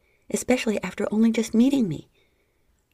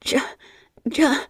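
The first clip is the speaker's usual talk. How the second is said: high, loud breathless yes; breathless cries; 7 semitones higher, 6 dB louder than usual. A narrator's reading. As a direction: let it escape, high and breathy. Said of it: "I couldn't stop myself".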